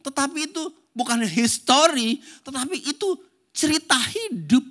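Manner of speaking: high, loud preacher voice driving the point home; full-force preaching. Acted. Preacher urging the congregation to teach their children, full force.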